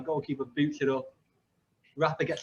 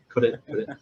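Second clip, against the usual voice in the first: ordinary talk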